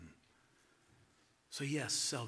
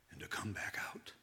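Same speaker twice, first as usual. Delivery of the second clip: whisper; 5 semitones lower, 6 dB quieter than usual. Acted. Whispered close.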